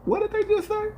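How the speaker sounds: high squeaky what-did-he-just-say; incredulous high-pitched double take; shocked disbelief. Retold or imitated. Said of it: "I'm like".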